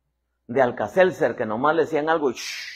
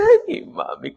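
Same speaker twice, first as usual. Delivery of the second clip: mocking kids; 6 dB louder than usual. Children mocking their mother.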